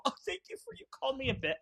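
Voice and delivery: high, mocking wounded falsetto; mock fake hurt. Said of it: "and I don't go".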